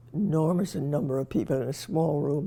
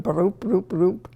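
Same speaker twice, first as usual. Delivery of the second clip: heartbeat noise; 3 semitones higher, 3 dB louder than usual. Retold or imitated. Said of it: "my heart went".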